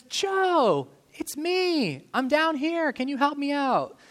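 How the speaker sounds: high, eager call up to a friend. Retold or imitated. Man in a hole calls up to his friend Joe, high and eager.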